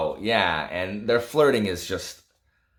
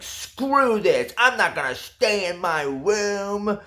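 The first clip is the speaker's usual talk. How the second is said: put-on high, loud whiny outburst of a sulking teen; bratty tantrum; 12 semitones higher, 4 dB louder, slower than usual. Reviewer voicing the heroine storming out of her room, in a high bratty tantrum. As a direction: stamp your foot and storm out. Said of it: "like, with a tantrum".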